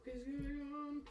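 high, very soft put-on voice; hushed, high-pitched confusion; bewildered aside. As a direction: say it small and high, as if baffled. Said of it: "just stops and sort of goes".

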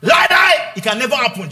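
high, loud, frantic protest; protesting loudly to Jesus; loud denial. Retold or imitated. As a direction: shout it down in disbelief. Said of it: "You know what Peter said?".

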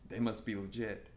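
low, soft mock awe; quietly impressed, imitating a younger self; murmured starstruck aside; hushed awe. Awed reaction to special guest.

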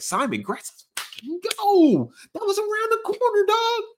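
high, thrilled hometown cheer; mock-excited fan hearing about a local hero; hyped-up excitement. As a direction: jump way up in pitch like your team just scored. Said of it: "I was like".

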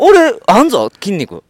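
mock tough boast. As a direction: puff up like a bully bragging. Voicing muscle boast. (高めの声でふざけた強がり)

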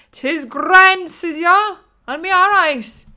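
loud, high, hammed-up 'tis grand; loud high mock bravado; mock brave bluster. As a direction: belt it out like a cocky joke. Mocking her own brave talk.

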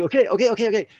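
high, rushed string of okays; eager to get going; excited okays. Retold or imitated. Young man agreeing to the plan, excited.

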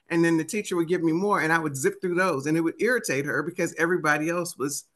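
ordinary delivery

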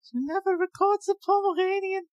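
very high squeaky pet voice; dog voice. Pretend. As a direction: speak as a tiny Pomeranian. Voicing the dog.

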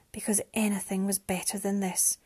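ordinary delivery, nothing marked

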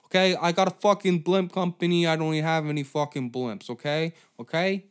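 put-on brash Italian-American wiseguy accent. Comedian voicing Lou with an Italian-American accent. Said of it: "he's getting an Italian accent".

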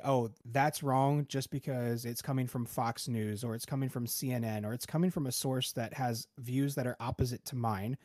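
ordinary talk, nothing marked

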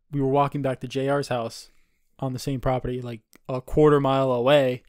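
normal talking voice

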